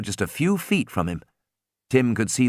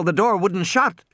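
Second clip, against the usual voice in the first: ordinary delivery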